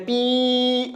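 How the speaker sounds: 'पी' held on a long level ee; long sustained ee in 'पी'; drawn-out vowel. Shown as a demonstration. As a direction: stretch the vowel of 'पी' right out. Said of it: "खींच करके".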